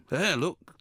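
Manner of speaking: ordinary delivery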